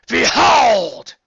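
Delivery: booming high proclamation; cried out. A narrator's reading. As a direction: proclaim it like a returning queen. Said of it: "she cried".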